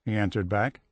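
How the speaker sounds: usual speaking voice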